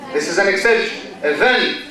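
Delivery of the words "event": "Event" stressed on the second syllable, e-VENT; short first vowel, strong VENT in "Event". Shown as a demonstration. Teacher corrects a common mistake, "Event" stressed e-VENT. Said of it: "The stress here is on the second syllable".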